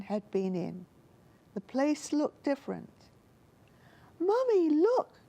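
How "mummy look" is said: high, louder squeaky cry of a small bear cub; squeaky little bear. A narrator's reading. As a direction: call out to mummy like a tiny bear. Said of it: "cried Little Bear in his squeaky voice".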